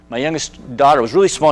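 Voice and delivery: usual speaking voice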